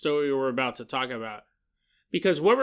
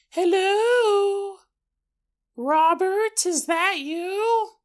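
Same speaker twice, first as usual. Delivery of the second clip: high, slow sing-song mother voice; creepy alien mimicking a mother; eerie mother's call; much slower than usual. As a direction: lure him out like a sweet mom.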